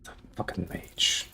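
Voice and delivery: hushed, slow annoyed whisper; whispered curse. Real, not acted. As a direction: breathe it out in irritation.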